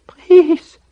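very high, strained, loud plea; thin scream of a whisper; screamy, breathless whisper. A narrator's reading. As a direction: beg like a dying man out of breath. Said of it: "whispered in a screamy, breathless voice".

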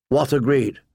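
ordinary delivery

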